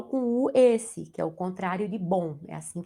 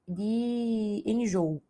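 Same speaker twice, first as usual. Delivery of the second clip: "enjoo" drawn out very slowly; slow, stretched out; much slower than usual. Shown as a demonstration. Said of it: "a letra A já estaria errada".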